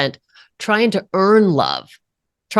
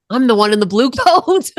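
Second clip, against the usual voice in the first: laughing; 7 semitones higher, 3 dB louder than usual. Real, not acted.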